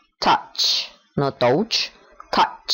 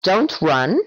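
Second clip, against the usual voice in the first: normal talking voice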